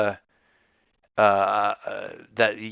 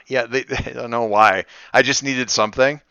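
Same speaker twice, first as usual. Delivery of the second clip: ordinary talk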